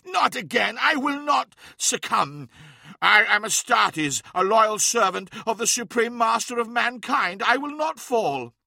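high, loud defiant vow; defiant strained shout. A narrator's reading. Araman resisting the transformation, defiant shout. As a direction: refuse the change with every word.

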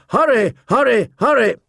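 high, falling calls; urgent trumpet cry. A narrator's reading. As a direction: cry it out like a horn call. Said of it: "the trumpets called".

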